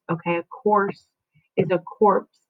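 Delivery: ordinary talk, nothing marked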